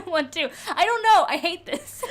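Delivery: high, loud laughing despair. Real, not acted. Cohost stuck on the quiz answer, laughing in frustration.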